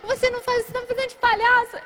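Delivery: high-pitched hurt protest; retold near-tears complaint. Girlfriend close to crying accuses boyfriend, hurt protest. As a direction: act out complaining on the verge of tears. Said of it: "comecei a querer chorar".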